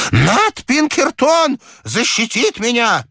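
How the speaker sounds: put-on high, drawn-out old man's cry; cling to hope in a high wail; slow, high-pitched insistence; agitated old man. A narrator's reading. Persecuted old man insists on protection.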